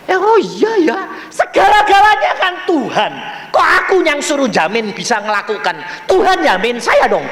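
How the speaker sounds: high, loud burst of insight; excited re-enacted self-talk; sudden realisation. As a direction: exclaim as it dawns on you. Acts out his sudden realisation.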